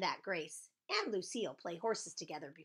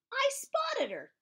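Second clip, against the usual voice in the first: excited spotting; 6 dB louder, slower than usual. A narrator's reading. Narrator excitedly spots missing friend.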